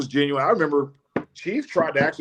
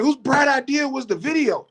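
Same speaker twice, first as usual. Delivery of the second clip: mock coach rant, voice high; high-pitched upset coach; 12 semitones higher than usual. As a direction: squeak it out like a big upset coach. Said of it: "his voice gets high".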